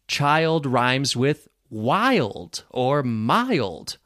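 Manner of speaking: put-on slow demo, long I stretched in "child", "wild" and "mild"; long I rhymes. Shown as a demonstration. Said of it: "that is a long I vowel sound".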